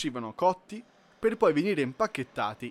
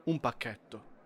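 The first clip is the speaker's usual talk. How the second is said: usual speaking voice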